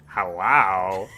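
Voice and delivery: low, loud, drawn-out ghost; deep ghostly hello; hollow ghost voice. Acted. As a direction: moan the greeting like a distant spook. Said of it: "The ghosts speak in many voices".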